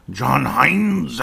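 a raspy imitated coach voice. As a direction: rasp out the name like an old boss. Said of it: "had kind of a raspy voice like that".

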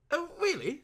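flabbergasted. Acted. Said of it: "feeling rather flabbergasted".

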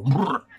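shuddering brrr. Acted. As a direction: shudder out loud at the thought. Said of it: "Wzdrygnął się na samą myśl".

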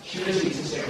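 softened, gentle compliment; tender reassurance, modelled for the class; trusting praise. Shown as a demonstration. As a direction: tell them gently that you trust them. Changes words into trusting praise.

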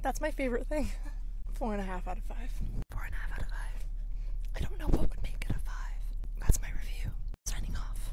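hushed ASMR whisper; voiceless, close-up whispering; whispered review. Acted. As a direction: whisper the verdict like an intimate review.

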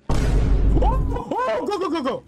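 high frantic urging; shouting go in a panic; excited yelling. Real, not acted. Panicked escape in game.

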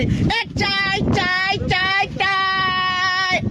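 drawn-out street vendor cry for tea; tea seller's call. Shown as a demonstration. Teaching a friend how to call customers, long vendor cry. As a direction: call out chai like a roadside hawker. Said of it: "પબ્લિકને બોલાવવું પડે, બૂમ પાડી".

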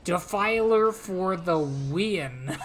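loud, slow victory shout; triumphant cheer. Real, not acted. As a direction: drag out the cheer like a champion. Cheering a battle win.

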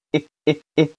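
诶 as a short, isolated vowel, repeated; teacher drilling short 诶 in separate beats; short weak vowel. Shown as a demonstration. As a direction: say 诶 short, with gaps between. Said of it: "就读它的短音".

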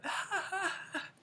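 squealing giggle; real giggle at own joke; high laugh. Real, not acted. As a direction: burst out giggling.

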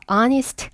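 "Honest" begun on the vowel, no H; silent H. Shown as a demonstration. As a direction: say "Honest" as "on-ist". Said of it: "H 발음 나지 않아서요".

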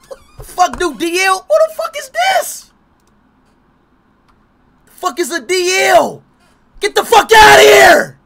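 high, loud, slow furious shout; real outraged shouting; outraged yell. Real, not acted. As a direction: yell it at the screen. Paywall blocks matches.

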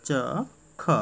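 च and ख as two separate sounds; sounded letter by letter. Shown as a demonstration. Teacher leading pupils in reading, च and ख sounded singly. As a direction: say च, pause, then ख. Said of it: "वाक्य मेरे साथ पढ़ना शुरू कर दो".

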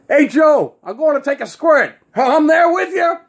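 high, loud hammed-up guy calling out; mock-eager announcing. Guy to his buddy Joe at the bar, mock-eager announcing. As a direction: sing out the news to a buddy. Guys mimic the women's announcing.

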